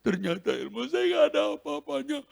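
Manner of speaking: high, put-on voice admitting defeat; the spirit conceding, in a high odd voice; defeated demon. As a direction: sound beaten and small. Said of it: "Terus saya gini".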